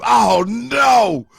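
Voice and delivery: high, loud cry of disbelief; mock dismay. Cousins cracking up over the joke, mock dismay. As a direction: holler it like a hammed-up horrified reaction. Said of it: "we started laughing. We was like".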